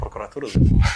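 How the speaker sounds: loud jokey announcement; loud mock announcement. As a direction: call it out loudly, joking. Joking retelling of prosecutor call.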